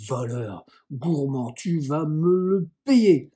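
insults building to a loud, drawn-out threat; furious threat. Acted. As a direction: threaten revenge, stretching out the last words. Cousin threatens the boy.

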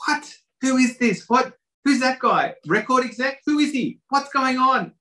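high, frantic voices crackling over the radio; frantic radio chatter. Retold or imitated. TV crew panicking over radios about the stranger, high frantic chatter. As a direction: squeal it like panicked crew on walkie-talkies. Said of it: "all their radios start going crazy".

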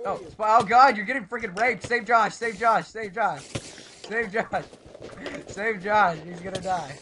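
high, loud frantic pleas; panicked shouting. Real, not acted. Player calls to save teammate under attack, panicked shouting. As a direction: shout urgently for a rescue.

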